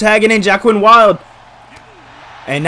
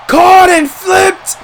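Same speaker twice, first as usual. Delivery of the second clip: shouting; 11 semitones higher, 4 dB louder, much slower than usual. Real, not acted. Calling a big move.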